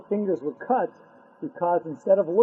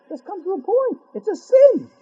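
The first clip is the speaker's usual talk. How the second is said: higher, louder, impatient outburst; exasperated; 13 semitones higher, 6 dB louder than usual. Acted. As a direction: cut to the chase, fed up. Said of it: "exasperated repeat".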